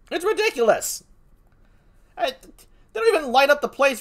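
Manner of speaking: "ridiculous" thrown up high in disbelief; an incredulous, high "It's ridiculous"; exasperated. Acted. Repeats verdict, pitch jumps.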